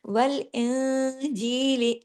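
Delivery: वल इंजील very slow, with a nasal hum on the न before ज; ghunna recitation. Shown as a demonstration. Teacher demonstrating ikhfa ghunna, very slow recitation. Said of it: "यहाँ पे गुन्ना किया मैंने".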